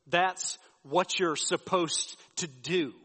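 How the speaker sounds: every word drawn out slowly, stressing "That's"; slow repeat. Retold or imitated. Wife slowly repeats her answer to her husband, drawn out and stressing "That's". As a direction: spell it out slowly, as if he should know.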